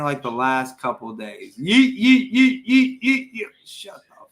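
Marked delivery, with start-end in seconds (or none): 1.6-3.5 high, loud mocking kid chant; high mocking kid chant of yeet; mimicking son. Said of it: "he's been saying". 3.6-4.3 whisper. Real, not acted. Annoyed parent hushing.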